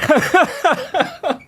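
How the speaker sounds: high-pitched amused laugh. Real, not acted. Host amused by his horrible accent, real laughing. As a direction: crack up at your own bad impression.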